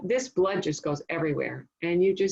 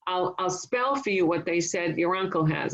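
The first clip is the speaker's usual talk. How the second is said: normal talking voice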